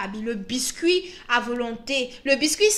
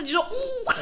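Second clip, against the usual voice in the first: spooky ooh; 12 semitones higher, slower than usual. Retold or imitated. Imitating glowing eyes in dark.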